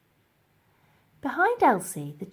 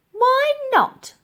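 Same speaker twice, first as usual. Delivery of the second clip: much higher, louder puzzled question; Dotty asking; 10 dB louder than usual. Acted. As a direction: innocent challenge. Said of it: "asked Dotty".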